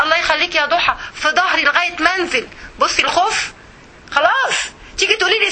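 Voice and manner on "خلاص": ordinary talk, nothing marked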